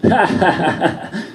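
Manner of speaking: high imitated belly laugh; mocking laughter. Americans laugh at hearing he's Polish, mocking.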